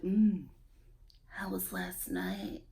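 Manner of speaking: low, slow, quiet pillow-talk murmur; Darcy. Retold or imitated. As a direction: drop it low and draw it out, mock seductive. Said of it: "Darcy's just like".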